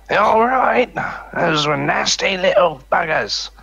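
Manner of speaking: high character voice. Acted. Wizard character speaks in-game.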